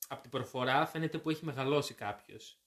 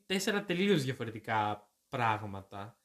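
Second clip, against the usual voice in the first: normal talking voice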